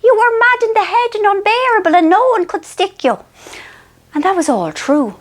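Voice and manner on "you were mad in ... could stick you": much higher, wise Irish mammy voice; mother soothing Marion, high and knowing; wise old mother. Retold or imitated. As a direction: deliver it like a sage old mother soothing her daughter. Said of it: "she goes".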